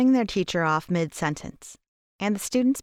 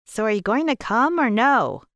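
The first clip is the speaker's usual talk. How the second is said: higher, louder playful asking; teasing teen boy; 4 semitones higher, 5 dB louder than usual. A narrator's reading. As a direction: coax your best friend with a grin. T J coaxing friend.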